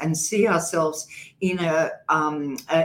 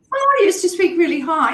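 normal talking voice

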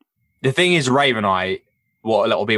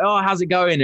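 light impression of a casual hello, pitched higher; imitating a casual greeting; 9 semitones higher than usual. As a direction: toss off the hello in a raised, easygoing voice. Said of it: "and he's like".

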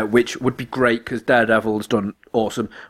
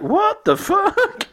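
imagined confusion; much slower than usual. Retold or imitated. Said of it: "he'll be like".

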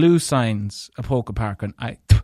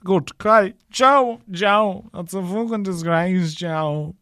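indignant outburst; 5 semitones higher, slower than usual. Real, not acted. Outburst to Joe about clampers.

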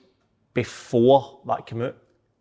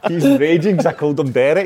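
laughing; 5 semitones higher, 6 dB louder, slower than usual. Real, not acted. Laughing at teasing a mate.

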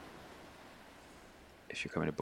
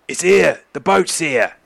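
loud, raised-pitch lookout call; 9 semitones higher, 7 dB louder than usual. Acted. Bandits at the back spotting the boat, calling out loudly. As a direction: announce it across the deck.

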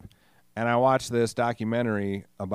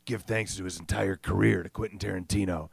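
a light low gravelly-free imitation of Michael Madsen; lightly voiced tough old guy; Michael Madsen impression; 3 semitones lower than usual. Podcaster doing a quick Michael Madsen voice. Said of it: "who talks like this".

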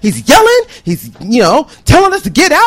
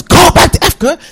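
short, louder mock holler; retold yell; 4 semitones higher, 5 dB louder than usual. Man yelling at the departing students, retold holler. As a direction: holler it quickly as a quoted yell. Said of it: "He yells".

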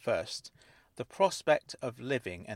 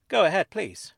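high, loud polite urging; insistent; 8 semitones higher, 9 dB louder than usual. A narrator's reading. Miss Angel urging the narrator to ask, insistent. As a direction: press them to go on. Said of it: "she insisted".

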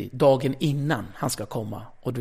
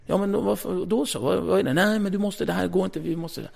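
much higher, pleading back and forth; exasperated pleading; 6 semitones higher than usual. Retold or imitated. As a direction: whine at the boss in disbelief. Retold quarrel with boss. (upprörd, pipig diskussion med chefen)

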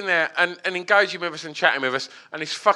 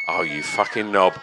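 self-scolding; 10 semitones lower than usual. Pretend. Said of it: "listen back to this and go".

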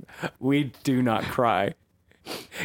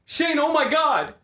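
high, loud screaming for Shane; hammed-up panic over a shot friend; panicked scream; 14 semitones higher, 4 dB louder than usual. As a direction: shriek his name in mock horror.

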